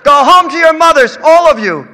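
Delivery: high loud yell; mother yelling at the crowd outside. A narrator's reading. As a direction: yell them off the lawn. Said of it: "Ma Mère yelled".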